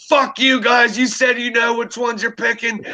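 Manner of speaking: high-pitched mock outraged rant; imitated angry yelling. Tripping friend blaming the guys who picked, mock yelling. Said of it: "He starts yelling at us".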